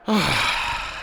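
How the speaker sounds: long, voiceless exhale; exasperated sigh. Real, not acted. Gamer after conceding a goal, exasperated sigh. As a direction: let out a heavy sigh.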